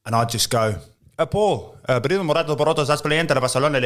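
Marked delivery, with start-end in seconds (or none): 1.2-3.9 higher-pitched fake Spanish patter; mock Spanish. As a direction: reel off random names like pretend Spanish. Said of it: "absolute random Spanish names".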